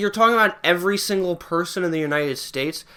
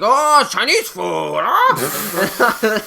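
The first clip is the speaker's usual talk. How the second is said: put-on slow, higher over-the-top Chinese accent; hammed-up Chinese accent, stretched out slowly; mock Chinese accent; 4 semitones higher, 5 dB louder, much slower than usual. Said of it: "doing a Chinese".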